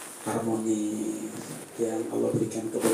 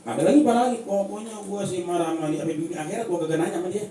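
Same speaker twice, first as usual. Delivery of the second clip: mocking preaching; 9 semitones higher, 3 dB louder, much faster than usual. Preacher mocks a lifelong grudge.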